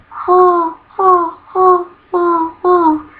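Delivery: high hooting owl calls, held long; weird owl hoots. A narrator's reading. Narrator voicing the owl's weird call, high hoots. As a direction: hoo like an owl in the dark wood. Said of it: "Her weird".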